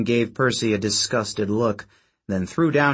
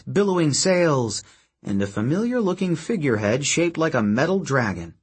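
plain reading voice

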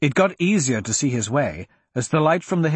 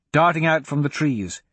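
ordinary delivery, nothing marked

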